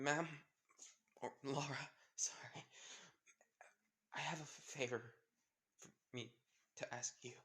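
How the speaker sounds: halting words with long gaps; dying, struggling speech. Acted. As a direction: act a dying mother running out of strength. Dying woman struggles to speak.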